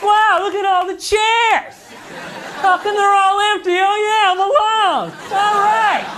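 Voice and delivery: a loser talking to his empty chairs; mock self-pitying whine. Comic mocking himself alone at a table of empty chairs, high whine. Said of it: "It's like".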